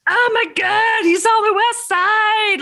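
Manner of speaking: thrilled recognition. Retold or imitated. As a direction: gush like a proud local. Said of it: "And I'm like".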